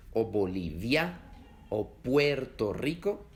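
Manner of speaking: normal talking voice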